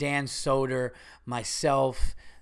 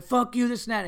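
higher-pitched mock aggression; retold escalation; 8 semitones higher than usual. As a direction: imitate someone escalating a fight. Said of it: "as opposed to being like".

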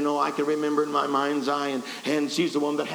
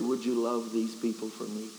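low, soft voice of the Lord; gentle; 6 semitones lower, 5 dB quieter than usual. Retold or imitated. The Lord asking the preacher to love people, gently. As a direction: ask it tenderly, low and soft. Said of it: "the Lord spoke to me so gently".

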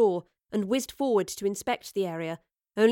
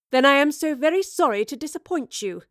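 raised, defiant reply; determined; 6 semitones higher, 6 dB louder than usual. A narrator's reading. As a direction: answer loudly with firm resolve. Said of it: "came a determined response".

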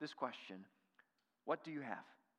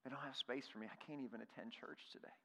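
put-on hushed, low, dejected child's words; tearful little girl; 3 semitones lower, 11 dB quieter than usual. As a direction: lightly voice a child's quiet hurt. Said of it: "she begins to cry".

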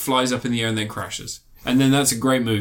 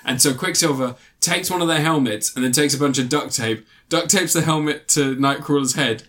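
usual speaking voice

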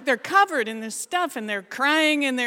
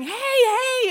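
sudden high yell for help; shouting; 13 semitones higher, 6 dB louder than usual. Retold or imitated. Girl yanked behind the wall, shouting in mock panic. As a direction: yell out as if being grabbed. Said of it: "and I start shouting".

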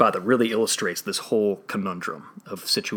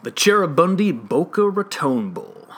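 announcer voice; 9 semitones higher, 7 dB louder than usual. Acted. Announcing the next bowl game.

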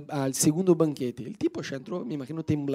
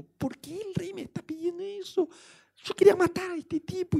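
high, thin, confused near-crying voice; near tears; 12 semitones higher, 5 dB quieter than usual. Acted. Amán humiliated, dressing his enemy, near tears. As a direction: whine it out, bewildered and about to cry. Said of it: "el tipo casi llorando".

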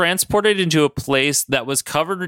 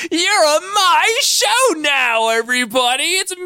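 Mojo voice; 13 semitones higher, 3 dB louder than usual. Retold or imitated. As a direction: ham it up like a TV host. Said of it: "Mojo walks out and he's like".

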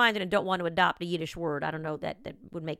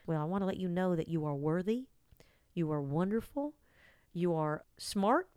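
mellifluous voice; 5 dB quieter than usual. Pretend. Host soothing podcast listeners toward sleep, softly. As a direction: lull them like a bedtime affirmation. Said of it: "talked like this in a more mellifluous voice".

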